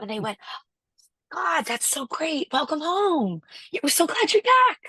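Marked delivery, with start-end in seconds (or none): 1.3-4.8 high, gushing retold greeting; imitated delighted welcome. Locals welcome the returning emigrant home, imitated gushing delight. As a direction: act out their welcome, voice lifting right up.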